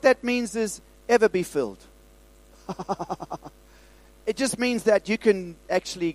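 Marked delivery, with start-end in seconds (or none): 2.7-3.5 soft chuckle; real, low-key burst of laughter. Real, not acted. Preacher laughs after his line.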